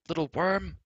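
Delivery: high angry yell at the traitor; shouting. A narrator's reading. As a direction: yell it at the top of your voice. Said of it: "shouted at the top of his voice".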